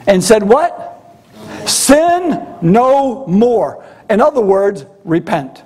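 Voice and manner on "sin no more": very slow, each word stretched; drawn out. Acted. Preacher repeating Jesus' command to the congregation, slow and weighty. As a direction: draw out "Sin no more" deliberately.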